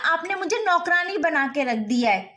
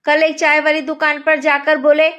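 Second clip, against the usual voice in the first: loud rustic villager telling a story; loud rural Hindi storyteller; rustic village accent; 6 dB louder than usual. Acted. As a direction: boom it out like a village uncle. Rustic man tells tea stall story.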